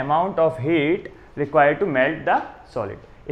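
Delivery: very slow, spaced-out phrases; slow dictation. Real, not acted. Teacher finishes the definition for students, slow dictation. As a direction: dictate word by word as you write.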